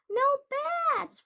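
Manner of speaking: high, loud monster roar. A narrator's reading. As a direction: bellow it like an angry monster. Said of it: "roared the monster".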